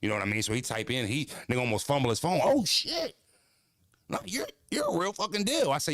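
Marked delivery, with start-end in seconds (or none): 2.4-5.7 much higher, awed skater-bro voice; stunned skater dude. Retold or imitated. The fan sees his channel, high stunned skater voice. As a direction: blown away like a skate kid.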